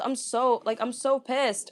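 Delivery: ordinary talk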